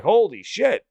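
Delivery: high, loud burst of mock astonishment; amazed reaction. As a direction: re-enact a stunned discovery, pitch leaping up. Acts out discovering new releases.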